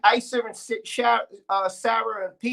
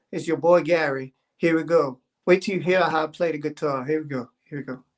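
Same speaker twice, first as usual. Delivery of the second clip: lower, laid-back dude voice as Gary; low easygoing guy voice, chatting to the stream; 6 semitones lower than usual. Acted. Streamer as Gary introduces his song, low laid-back dude.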